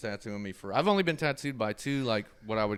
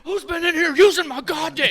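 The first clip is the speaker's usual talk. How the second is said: high, loud enraged yell imitating the tattooer; freaking out; 14 dB louder than usual. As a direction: explode in a furious high-pitched outburst. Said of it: "he starts freaking out".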